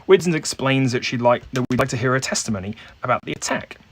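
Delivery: ordinary talk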